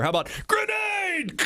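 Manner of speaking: high, strained mock shout; mock yell. Child warning everyone on shore, high mock yell. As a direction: shout it high like an alarmed kid. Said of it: "picked up the explosive and yelled".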